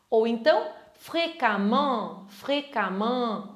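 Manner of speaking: fréquemment repeated very slowly, syllable by syllable; model pronunciation. Shown as a demonstration. Teacher slowly models fréquemment for students. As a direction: stretch out fréquemment for learners. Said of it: "Observe como se escreve e a pronúncia".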